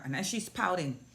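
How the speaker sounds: ordinary talk, nothing marked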